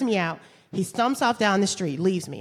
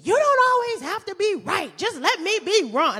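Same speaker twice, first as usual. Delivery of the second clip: put-on angry boyfriend; 11 semitones higher than usual. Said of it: "And he said".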